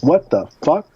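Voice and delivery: shocked outburst. Retold or imitated.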